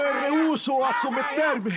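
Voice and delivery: slow, drawn-out shout of refusal; defiant shout. Acted. Condemned man refusing to submit, defiant shout. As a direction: cry out in open defiance.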